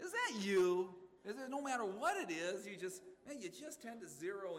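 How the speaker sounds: loud, high challenge; pointed call-out. Real, not acted. Preacher confronting the congregation with a loud, pointed challenge.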